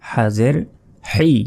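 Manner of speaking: হেই pushed out with pressure in the throat; throat pressed. Shown as a demonstration. Teacher drills Quran letters, throat pressed. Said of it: "গলায় চাপ দিয়ে".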